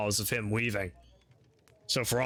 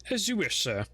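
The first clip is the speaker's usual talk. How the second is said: butler voice; 7 semitones higher than usual. Acted.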